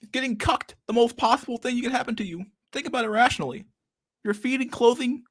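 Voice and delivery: Jordan Peterson impression. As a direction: parody a grave self-help lecturer rambling. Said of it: "Like, Jordan, please".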